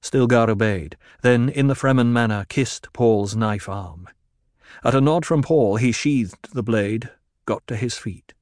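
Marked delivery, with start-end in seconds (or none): none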